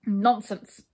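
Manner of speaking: loud angry dismissal; storming. A narrator's reading. King dismissing the brownies' excuse, storming. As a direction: burst out crossly. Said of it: "stormed the king".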